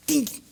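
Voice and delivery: tiny high "dink"; imitated feeble swing.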